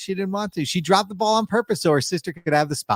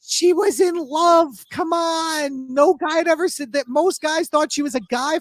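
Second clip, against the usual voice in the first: passionate shout; 11 semitones higher than usual. Real, not acted. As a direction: shout her defence of Marla with all your heart. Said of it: "You seem really upset about this".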